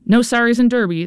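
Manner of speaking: mild mock yell. A narrator's reading. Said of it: "the coaches yelled".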